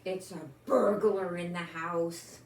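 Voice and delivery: ordinary delivery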